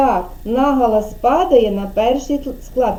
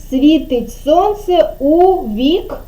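"Світить сонце у вік" slow, higher, hanging on the end; leaving word unfinished; 4 semitones higher, much slower than usual. Shown as a demonstration. Teacher prompting a child to finish, "Світить сонце у вік" slow and suspended. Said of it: "а ви будете закінчувати".

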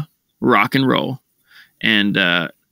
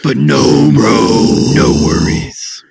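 loud hyped wrestler shout; pro wrestler voice; 3 semitones higher, 5 dB louder, slower than usual. Pretend. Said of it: "I fell into professional wrestler way too fast there".

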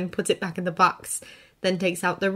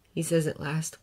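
ordinary delivery

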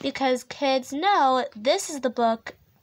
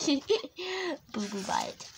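laughing; 8 dB quieter, much slower than usual. Real, not acted. Laughing at silly name.

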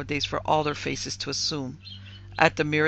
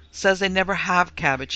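gloomy triumph; 4 semitones higher, 6 dB louder than usual. A narrator's reading. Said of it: "with gloomy triumph".

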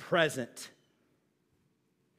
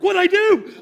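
loud, high, hurt protest; a hammed-up what did I do; mock innocent outcry; 11 semitones higher, 13 dB louder than usual. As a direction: act wounded and baffled. Caps person replies, offended.

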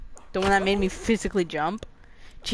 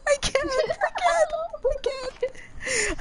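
panicked; 13 semitones higher, much slower than usual. Real, not acted. Overwhelmed after jump scare.

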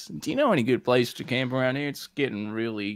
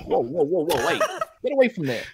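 high, panicky calls to back off; startled, high-pitched protest; alarmed warning; 13 semitones higher, 4 dB louder than usual. Acted. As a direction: ward someone off urgently. Warding off whooping boy.

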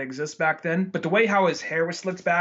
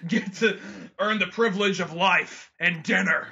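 hammed-up anime mentor; 4 semitones higher than usual. Anime lion lesson.

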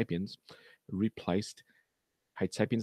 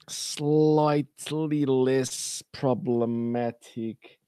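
loud, higher, drawn-out words; pointed emphasis; 5 semitones higher, 5 dB louder, much slower than usual. Real, not acted. Presenter pointedly recommending other articles to viewers, pointed emphasis. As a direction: stretch each word with heavy, deliberate emphasis.

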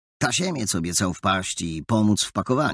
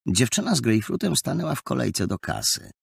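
ordinary delivery, nothing marked

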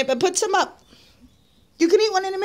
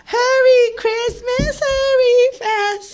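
playful plea; 6 semitones higher, 5 dB louder, much slower than usual. Acted. Mother urging Christmas to come.

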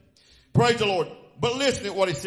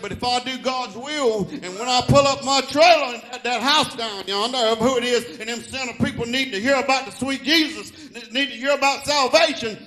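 loud, higher, impassioned preacher delivery; full-force preaching; 5 semitones higher, 6 dB louder than usual. Real, not acted. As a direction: preach it loud and high to the congregation.

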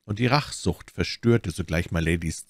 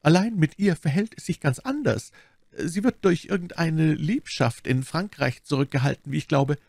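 lightly voiced abbess, raised pitch; explaining abbess; 10 semitones higher than usual. A narrator's reading. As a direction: confide it in a higher, polite voice.

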